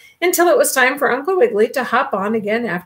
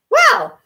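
throat clear; 14 semitones higher, 5 dB louder than usual. A narrator's reading. Resigned after carrots.

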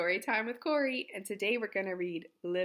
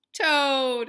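loud, higher, drawn-out announcement; dramatic reveal; 6 semitones higher, 11 dB louder than usual. A narrator's reading. Reader reveals who came to help, loud flourish. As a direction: announce the hero with a big flourish.

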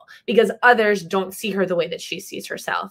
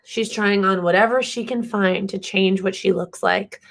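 ordinary delivery

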